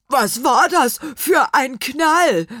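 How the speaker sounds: high, loud, slow question; loud, astonished character voice; startled little apple man. A narrator's reading. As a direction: demand to know about the bang, word by word. Apple man demands explanation of bang.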